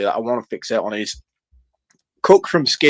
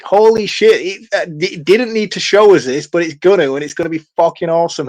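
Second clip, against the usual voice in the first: higher, louder retold amazement; raised, eager mock exclamation; excited reaction; 5 semitones higher, 4 dB louder than usual. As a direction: relive being thrilled by a film. Retelling own excited reaction to film.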